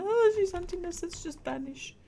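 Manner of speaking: high mock ghost voice; hammed-up ghost whispering to flee; ghosts' voice. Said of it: "they've all gone".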